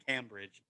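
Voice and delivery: Cambridge softly, with short a as in camera; wife's pronunciation. Retold or imitated. Host correcting himself on his wife's version, Cambridge softly as "CAM-bridge". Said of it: "My wife likes to say".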